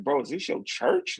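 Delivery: amazed disbelieving question; light, surprised retelling. Retold or imitated. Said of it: "they was like".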